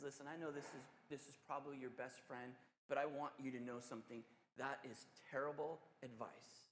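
soft, gentle, low pastoral voice; loving counsel. Retold or imitated. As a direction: counsel her kindly and softly, like a caring pastor. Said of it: "And he just so lovingly says".

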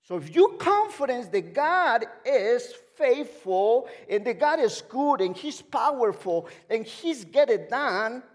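loud, high, emphatic pulpit delivery; full-force preaching. Real, not acted. Preacher asking church if God is faithful, loud and fervent. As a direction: preach it out big, stretching key words.

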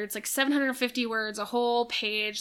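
ordinary talk

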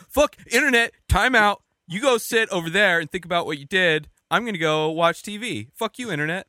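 high, loud pretend telling-off; hammed-up parent giving a time-out; mock scolding parent. Parent sending the internet to time out, mock-angry.

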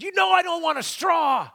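high, loud angry shout, imitated; yelling. Angry customer at the drive-through window, yelling. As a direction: yell it like an irate customer, drawing out the end. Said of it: "And he yelled at me".

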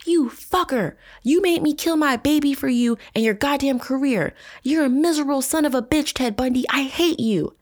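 screaming abuse at Ted after the betrayal; furious shout. Acted. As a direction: hurl every insult at him. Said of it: "I shouted".

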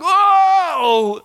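high, loud astonished shout; amazed whoa. Retold or imitated. Man seeing the Father behind him, shouting amazed. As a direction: exclaim in awe. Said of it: "I'm like".

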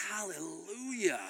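slow, drawn-out hallelujah; drawn-out mock rejoicing. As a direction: stretch it out like a comic revelation. Celebrating finding the pretzels at last.

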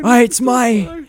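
high, drawn-out wailing mock scream; retold scream. Retelling Nicolas Cage's lost-hand meltdown, mock screaming. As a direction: cry out loud and slow in hammed-up anguish. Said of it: "he's screaming".

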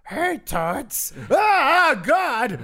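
high, loud puppet lady shriek; Lady Fairchild voice. Acted. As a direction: squawk like a prim puppet lady. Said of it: "Lady Fairchild from Mister Rogers' Neighborhood".

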